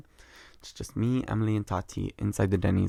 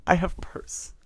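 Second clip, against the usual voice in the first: breathy whisper; 8 semitones higher, slower than usual. Pretend. Put-on quip after slogan.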